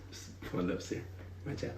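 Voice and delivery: hushed secret whisper. Real, not acted. Speaker hushing the crowd on the key word, whispered. Hushed aside about lips.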